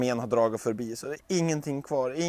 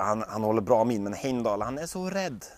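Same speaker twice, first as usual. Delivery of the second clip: ordinary delivery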